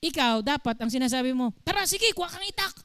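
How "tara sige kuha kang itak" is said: higher, quick, eager goading voice; wrong answer voiced as an eager instigator; mock egging on. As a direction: urge the fight along, quick and excited. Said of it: "Hindi dapat ganun ang isasagot mo".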